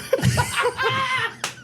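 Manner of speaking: squealing laugh, way up high; high-pitched laughter. Real, not acted. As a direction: burst into a shrieking laugh. Loses it at own punchline.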